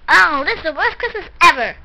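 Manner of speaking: usual speaking voice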